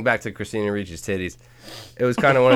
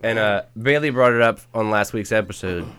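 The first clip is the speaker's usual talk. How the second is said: ordinary talk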